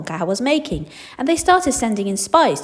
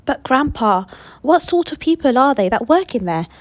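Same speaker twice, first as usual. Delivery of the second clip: curious pleading; 5 semitones higher, 5 dB louder than usual. Acted. Charlie pressing Grandpa.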